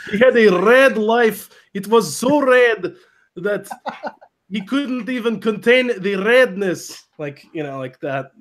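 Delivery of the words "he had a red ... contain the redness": high, slow hammed-up Russian accent; dramatic mock-Russian emphasis. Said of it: "I can do a Russian accent".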